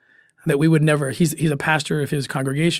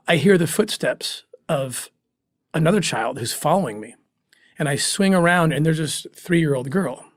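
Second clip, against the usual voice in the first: normal talking voice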